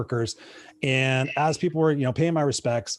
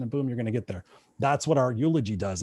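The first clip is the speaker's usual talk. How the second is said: ordinary delivery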